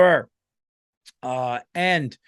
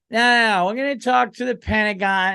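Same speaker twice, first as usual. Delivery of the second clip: higher-pitched, belligerent mock drunk woman; drunk woman in a bar; 6 semitones higher than usual. Drunk-chick president refusing to talk to the Pentagon, mock belligerent. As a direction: play an outraged tipsy president. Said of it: "just a drunk chick in the bar".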